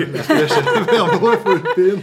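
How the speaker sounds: bursting into laughter mid-sentence; laughing. Real, not acted. Laughing while bragging about pedal.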